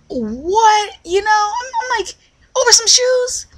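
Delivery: high, loud, incredulous cry; shocked disbelief. Retold or imitated. Hearing gun pops over shoes, high shocked cry. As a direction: squeal it out in disbelief.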